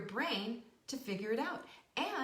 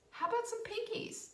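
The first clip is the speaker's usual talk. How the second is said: "How about some pinkies?" much higher, rallying; excited call; 14 semitones higher than usual. Shown as a demonstration.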